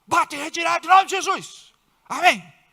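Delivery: casting out the demon, rising in force; rebuking prayer. Shown as a demonstration. Wife rebuking the demon in her husband, building command. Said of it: "ora baixinho e diz".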